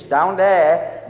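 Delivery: usual speaking voice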